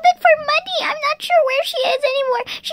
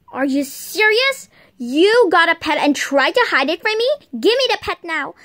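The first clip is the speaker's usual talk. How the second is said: scolding mom voice; 8 semitones lower than usual. Pretend.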